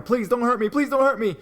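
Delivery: high, loud desperate begging; terrified pleading. Retold or imitated. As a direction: beg for your life, loud and high. Victim pleading with attacker.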